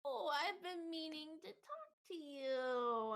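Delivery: high, slow, drawn-out mom voice; imitated chatty mom. Friend's mom corners a stoned guest, high chatty voice.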